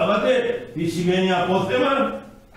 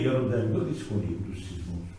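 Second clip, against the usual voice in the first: hushed quick warning; 8 semitones lower, 7 dB quieter, faster than usual. Retold or imitated. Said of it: "του λέει".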